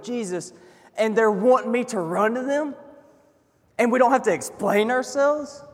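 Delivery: impassioned questioning sermon; fervent preaching. Real, not acted. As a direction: build the wonder like a preacher. Preacher builds in fervour.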